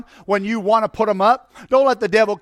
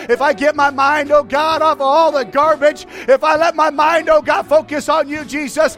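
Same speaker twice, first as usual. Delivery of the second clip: building preaching; 5 semitones higher, 3 dB louder than usual. Acted. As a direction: climb to a shouting climax.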